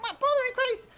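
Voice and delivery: hammed-up indignant telling-off; exasperated boss. Boss to worker giving attitude, indignant.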